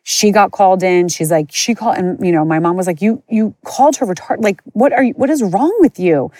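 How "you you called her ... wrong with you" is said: indignant mom. Retold or imitated. Retold mom confronting the teacher.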